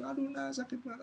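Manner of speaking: high, soft moan of pain; mock pained whimper; aching old mother.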